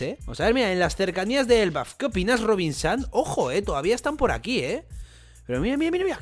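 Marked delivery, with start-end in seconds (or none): 5.5-6.2 excited. Real, not acted. Excited at the screen.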